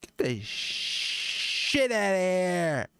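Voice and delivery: high, drawn-out mock yell; yelling at intruder. Homeowner to a hobo in the yard, stretched mock yell. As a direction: holler it long to chase someone off. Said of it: "your yard has a random hobo".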